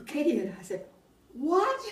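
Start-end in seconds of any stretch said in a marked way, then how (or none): 1.3-1.8 high, loud shocked cry; shocked what. Retold or imitated. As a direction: yelp it out in disbelief. Girls shocked at the news.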